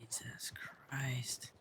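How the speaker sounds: hushed unvoiced whisper. Real, not acted. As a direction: breathe it under your breath. Muttered aside after officer leaves.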